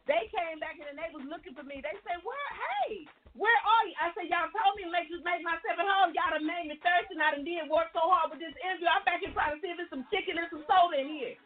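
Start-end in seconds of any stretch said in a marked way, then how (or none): 2.2-4.0 calling out. Retold or imitated. Staff calling out looking for her. 4.3-11.3 playful excuse. Retold or imitated. Playful reply to interviewers.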